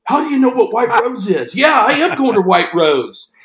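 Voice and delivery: high, excited disbelief; mock freak-out, high and animated; imitating freaking out. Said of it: "Now he's really freaking out".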